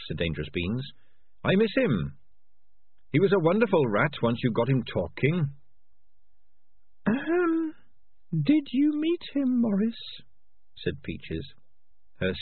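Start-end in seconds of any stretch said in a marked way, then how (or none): 1.4-5.5 higher, fond little rat voice; higher-pitched, innocent rat; wistful rat. Acted. Voices Dangerous Beans missing his friend. 7.0-10.0 very high, thin, slow rat voice; squeaky, accusing little rat; pointed female rat. Acted. Peaches questions Morris pointedly.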